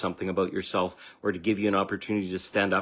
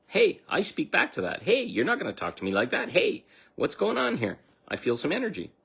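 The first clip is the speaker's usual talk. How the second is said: assertive new self; 8 semitones higher than usual. Acted. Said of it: "Be a different person".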